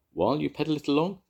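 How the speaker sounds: high, fast eager question; excited. A narrator's reading. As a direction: rush it with glee. Excited planning.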